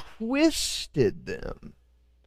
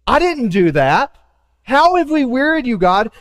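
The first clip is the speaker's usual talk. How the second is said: mock-innocent, higher and louder; indignant protest; 6 semitones higher, 4 dB louder than usual. People protesting their innocence to God, indignant. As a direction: play the wounded innocent. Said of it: "we always love to presume our innocence".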